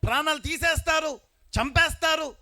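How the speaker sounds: rising to a high, loud preaching cry; preaching build-up. Acted. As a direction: build the point up to a shout. Preacher warns of persecution. (పెరుగుతున్న ఉత్సాహంతో ప్రసంగం)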